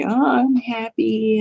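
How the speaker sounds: singsong put-on cheer; fake happiness. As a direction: mimic someone lying about being happy. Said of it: "They'll lie and they'll say".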